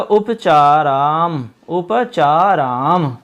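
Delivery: slowly sounding उपचाराम; slow dictation. Shown as a demonstration. Teacher dictates the word part for students. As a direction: stretch उपचाराम syllable by syllable. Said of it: "इसको हमने अलग-अलग कर दिया है".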